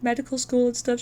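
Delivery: usual speaking voice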